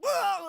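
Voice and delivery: loud, high-pitched acted whoop; imitated shriek of shock; mock startled yell. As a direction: let out a hammed-up shocked holler. Acting out full-on startled reaction.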